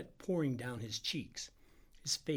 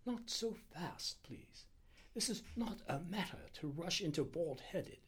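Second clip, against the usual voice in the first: high, softer doctor voice; cautioning Indian doctor; 6 semitones higher, 5 dB quieter than usual. Acted. Doctor slowing his impatient helper, high soft caution. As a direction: hold them back with careful restraint.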